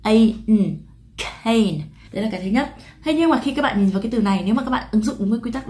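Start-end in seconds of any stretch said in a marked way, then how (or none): 0.0-1.8 letters A and N spelled loudly, then "cane" said as one word; đánh vần A, N rồi đọc cane; spelled then read. Shown as a demonstration. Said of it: "mình đọc thành là K".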